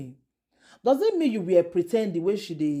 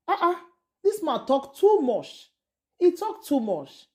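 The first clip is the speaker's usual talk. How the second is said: slow, higher, drawn-out disbelief; exasperated scolding; 8 semitones higher than usual. Real, not acted. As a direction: lay it on slowly, fed up with him. Exasperated vlogger scolding the husband.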